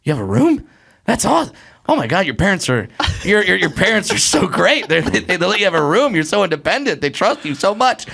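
put-on high, eager kid gushing; boyish excitement. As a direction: gush like a thrilled fourteen-year-old. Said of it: "And I'm like".

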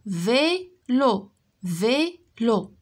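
"Vélo" split into vé and lo with a gap; syllable by syllable. Shown as a demonstration. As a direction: say "Vélo" as two drawn-out beats, vé, lo. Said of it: "Frappe les syllabes".

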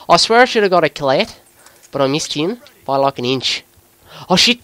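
put-on Aussie gamer commentary; Australian accent. Gamer talking viewers through a match, Australian accent. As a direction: narrate the game in an Australian accent.

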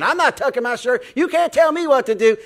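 high, loud indignant refusal; defiant worker. Acted. Worker refusing the manager's shirt policy, defiant. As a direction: whine back loudly in mock defiance.

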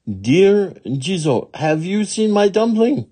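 high-pitched appeal, rising in urgency; pleading little woman. Acted. Little woman to another Jizo, high pleading.

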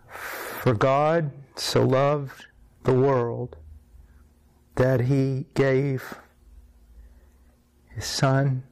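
reading the verse very slowly, voice thick with tears; each word drawn out like it costs him; a grown man reading through tears; slow tearful reading. Retold or imitated. Weeping man reads John 3:16.

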